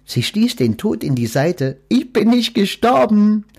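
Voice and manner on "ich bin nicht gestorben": high, loud triumphant quack; quacked delightedly. A narrator's reading. Duck proudly announcing to Death she is alive. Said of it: "quakte sie hochzufrieden".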